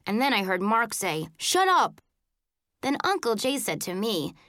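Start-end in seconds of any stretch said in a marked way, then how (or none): 1.4-2.0 higher-pitched, irritated retort. A narrator's reading. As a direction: shut them down with irritation. Brother snaps back in retold quarrel.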